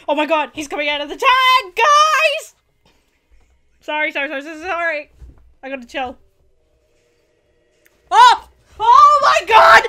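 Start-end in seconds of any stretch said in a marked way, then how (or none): 0.1-2.5 louder, rising to a high scream on guys; losing it over the reveal; excited shout. Real, not acted. Fan reacting to big reveal. 8.1-9.8 high, very loud, slow squealing scream. Real, not acted. Fan screams at a reveal.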